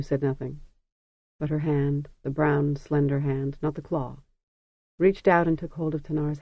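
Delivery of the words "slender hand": usual speaking voice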